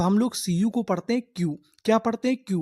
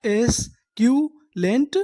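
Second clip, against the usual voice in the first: very slow, rising at the end; slow teacher model; slow drawn-out reading; 2 semitones higher, much slower than usual. Shown as a demonstration. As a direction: stretch एस्क्यूलेंट out for the class. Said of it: "हैं English में?".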